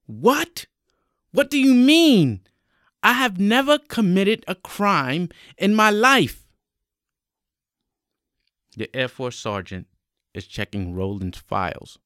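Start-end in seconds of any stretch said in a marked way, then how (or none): 0.1-6.5 high, loud, incredulous outburst; shocked protest. Acted. Applicant told by sergeant he failed background check, shocked protest. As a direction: protest your innocence in raised disbelief.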